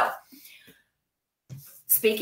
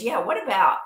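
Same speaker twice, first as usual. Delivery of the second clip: that inflection; 6 semitones higher, 4 dB louder than usual. Retold or imitated. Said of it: "just with that inflection".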